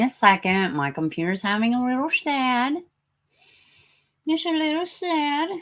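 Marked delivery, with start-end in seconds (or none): 1.4-5.5 high little pouty voice; mock-sad. Streamer excuses the stalled computer, mock-sad high voice. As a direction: pout it in a high, put-on voice.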